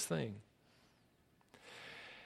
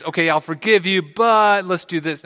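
louder, higher mock concession with a catch; bargaining forgiveness; 5 semitones higher, 5 dB louder than usual. Someone forgiving another on conditions, mock bargaining. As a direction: grant it, then drag out the condition.

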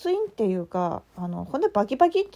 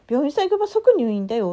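louder imitated scolding in Tsugaru dialect; retold stern Tsugaru dialect warning; 4 semitones higher, 6 dB louder than usual. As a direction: warn her off in the teacher's Tsugaru dialect. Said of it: "むっちゃ怒られました".

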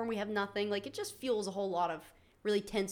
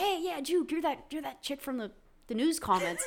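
higher, excited fan voice, laughing at the end; hammed-up tipsy stranger recognising her; drunk fan; 6 semitones higher, 4 dB louder than usual. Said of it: "and they're like".

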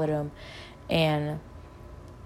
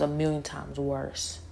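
ordinary talk, nothing marked

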